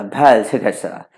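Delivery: slow, anglicized bal chikitsa; Englishman reading Hindi. Retold or imitated. Teacher mimicking a foreigner reading Hindi, English accent. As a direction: read बाल चिकित्सा like an English speaker, flat vowels. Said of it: "कोई अगर आ गया ... कैसे पढ़ेगा".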